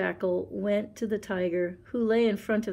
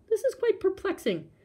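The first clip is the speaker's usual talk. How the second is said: perplexed; 12 semitones higher than usual. Acted. Jackal perplexed.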